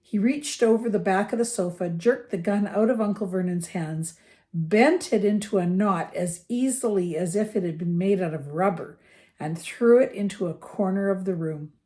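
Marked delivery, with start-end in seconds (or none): none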